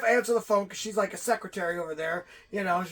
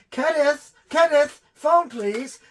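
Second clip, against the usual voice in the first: calling out to Kenneth; 7 semitones higher, 8 dB louder, slower than usual. Retold or imitated. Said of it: "And she's like".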